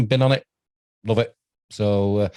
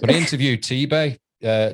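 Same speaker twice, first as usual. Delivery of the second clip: ordinary talk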